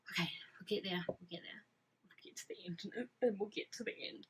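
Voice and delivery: hushed, murmured to herself, reining in; whispered self-reminder. Real, not acted. Vlogger hushes herself to avoid spoilers, whispering. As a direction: whisper it to yourself so you don't spoil anything.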